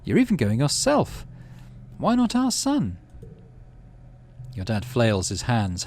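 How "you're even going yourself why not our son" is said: mum's voice. Acted. Mum arguing, pitched high.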